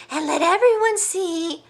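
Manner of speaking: high, loud bird call. Acted. Hornbill calling into the hollow tree, high and loud. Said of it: "she called".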